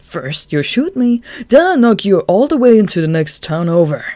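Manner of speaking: loud, high brash challenge; cocky taunt. Acted. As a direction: taunt the gunman cheerfully. Chuya taunting the gunman.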